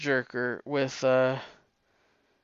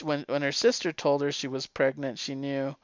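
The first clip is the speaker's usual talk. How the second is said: ordinary delivery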